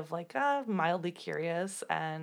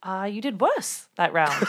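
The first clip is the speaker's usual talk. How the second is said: put-on British accent; 7 dB louder than usual. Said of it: "Did you like my accent?".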